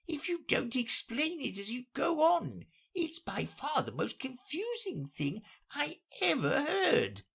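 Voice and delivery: very high, plaintive creature voice; complaining Mock Turtle. Mock Turtle interrupts the recital, high complaining voice. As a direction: interrupt in a high-pitched grumble.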